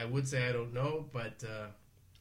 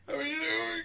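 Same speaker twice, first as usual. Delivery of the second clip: laughing. Real, not acted. Laughing greeting, breaking up.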